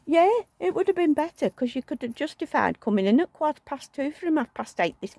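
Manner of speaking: high exasperated retort; mock exasperated. Wife retorting to husband's excuse, high and exasperated. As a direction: push back, pitched high.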